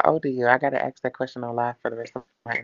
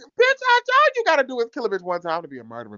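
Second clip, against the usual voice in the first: high-pitched, rapid shouted rebuttal; loud argument; 13 semitones higher, 4 dB louder, much faster than usual. Real, not acted. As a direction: talk right over them, loudly. Argument, shouting over the other.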